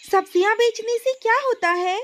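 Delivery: a small sister full of questions; little girl. Acted.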